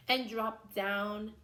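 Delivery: normal talking voice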